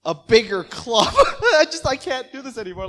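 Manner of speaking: high, loud, breaking into real laughter; helpless laughing exasperation; laughing in disbelief. Real, not acted. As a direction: crack up laughing through the words. Cracks up at the absurd plan.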